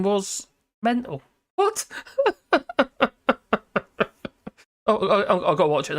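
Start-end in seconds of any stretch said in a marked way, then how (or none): 1.5-1.9 a sharp, much higher "What?"; surprised. Real, not acted. Streamer reacting to a chat fact, shocked and high. 1.9-4.5 a high, falling burst of laughter; real laugh. Real, not acted. Streamer laughing at a chat comment, high laugh.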